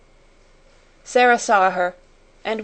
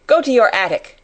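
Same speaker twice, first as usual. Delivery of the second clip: louder, higher stern order; commanding; 4 semitones higher, 6 dB louder than usual. A narrator's reading. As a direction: order them off sharply. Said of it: "she commanded".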